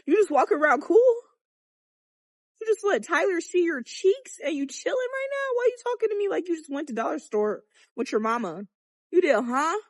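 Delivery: high, loud mock disbelief; shocked teasing friend. Teen teasing school friends who lost their virginity, high, loud mock shock. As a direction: gasp at your friend and tease her hard. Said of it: "And I'd be like".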